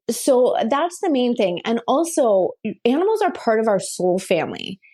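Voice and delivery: ordinary talk, nothing marked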